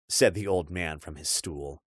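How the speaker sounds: ordinary delivery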